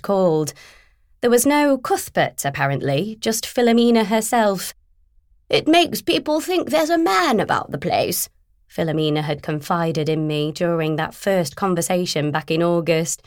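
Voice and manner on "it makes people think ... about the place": higher, confiding voice of the agent; lightly voiced, confiding businesswoman. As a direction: let her share a little secret. Philomena confiding.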